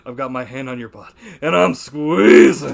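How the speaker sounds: loud, hammed-up creepy boss-grabber voice; mock Rob Schneider line. Retelling the film scene to a friend, hammed-up loud creep voice. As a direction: belt it out as a sleazy goofball. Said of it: "he's like".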